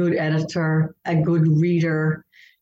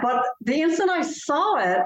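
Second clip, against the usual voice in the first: ordinary talk, nothing marked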